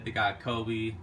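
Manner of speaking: ordinary delivery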